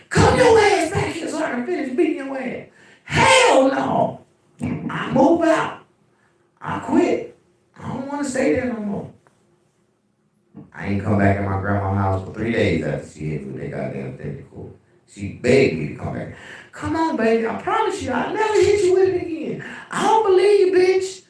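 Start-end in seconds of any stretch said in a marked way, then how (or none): 0.1-2.7 high, far-off-sounding mock grandma yell. Grandma calling the runaway grandson back. 3.0-9.1 put-on kid refusing. Runaway kid refusing to come home. 16.7-19.6 high, sweet mock grandma plea; grandma pleading. Grandma begging her grandson to come home. 19.9-21.2 put-on high, loud childlike retort; kid retorting. Kid refusing grandma's promise.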